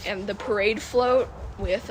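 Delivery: ordinary talk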